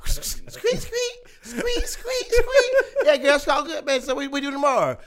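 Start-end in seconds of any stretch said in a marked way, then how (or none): 0.1-2.7 high squeaking noise; squeaky rubber duck. Acted.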